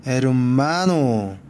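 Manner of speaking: 에르마노 with a long, stressed 마. Shown as a demonstration. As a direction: draw out the 마 in 에르마노, then fall away. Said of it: "끝에서 두 번째". (에르마노의 '마'를 길게 강세)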